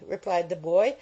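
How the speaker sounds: ordinary delivery, nothing marked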